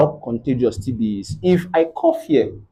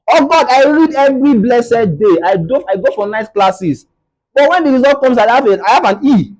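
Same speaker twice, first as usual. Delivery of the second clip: pleading complaint to God; 10 semitones higher, 3 dB louder than usual. Retold or imitated. Frustrated student complaining to God.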